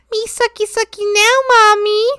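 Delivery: put-on high, loud babyish voice; imitating roommate. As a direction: squeal it like a creepy baby. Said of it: "Mimi's vocal stims are like".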